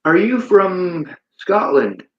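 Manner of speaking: a slow, hammed-up Scottish accent. Asking Peter in the chat where he's from, in a mock Scottish accent. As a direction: put on a bad Scottish lilt and drag it out. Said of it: "My Scottish accent sucks".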